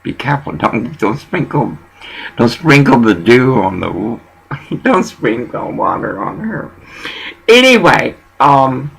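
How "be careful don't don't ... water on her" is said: soft, quiet calming of him; soothing hush. Retold or imitated. Calming Orville quietly after he yells at a worker. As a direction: settle him down gently. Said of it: "I said".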